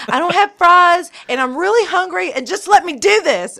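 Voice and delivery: mock whiny pleading. Husband begging wife for tenders, high mock whine. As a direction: whine for the food like a hungry kid. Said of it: "And he was like".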